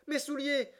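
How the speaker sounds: high-pitched involuntary cry; startled exclamation. A narrator's reading. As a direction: blurt it out in surprise, high. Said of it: "ne put retenir cette involontaire exclamation". (exclamation involontaire de surprise, voix aiguë)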